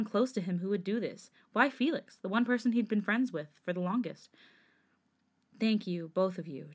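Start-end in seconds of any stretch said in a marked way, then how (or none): none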